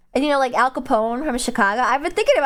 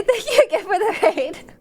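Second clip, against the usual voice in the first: higher, giggling thank-you; laughing; 8 semitones higher than usual. Real, not acted. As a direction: thank them through a laugh. Laughing thanks then redo.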